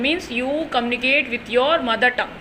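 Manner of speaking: ordinary delivery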